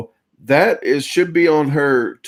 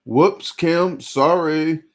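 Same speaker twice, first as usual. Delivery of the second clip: normal talking voice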